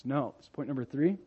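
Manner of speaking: ordinary talk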